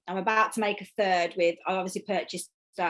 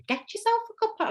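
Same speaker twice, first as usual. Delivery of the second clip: much higher, quick attempt at a Scottish accent; much faster than usual. Quoting the vlogger Emma's catchphrase in a Scottish accent. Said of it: "I can't do a Scottish accent".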